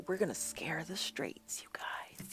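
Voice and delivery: hushed conspiratorial whisper. Pretend. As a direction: lean in and whisper it. Playful conspiratorial aside.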